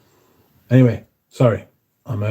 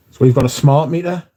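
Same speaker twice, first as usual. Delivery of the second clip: usual speaking voice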